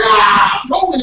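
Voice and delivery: loud eager shout; loud fired-up morning cry; pumped up shout. Retold or imitated. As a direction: burst out the door ready.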